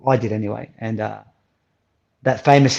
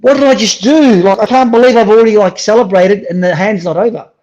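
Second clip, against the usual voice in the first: panicked; 8 semitones higher, 8 dB louder than usual. Retold or imitated. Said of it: "I go".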